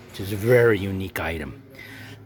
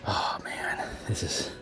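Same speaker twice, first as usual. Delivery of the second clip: hushed awe; 2 semitones lower, 10 dB quieter, slower than usual. Real, not acted. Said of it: "sorry I talk like that".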